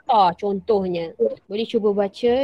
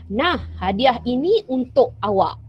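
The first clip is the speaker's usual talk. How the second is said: normal talking voice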